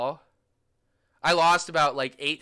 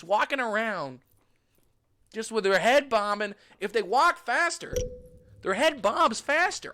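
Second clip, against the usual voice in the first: high-pitched, emphatic marveling; amazed gushing; 9 semitones higher, 3 dB louder than usual. Real, not acted. Traveler marvels at bobbing pigeon heads, amazed gushing.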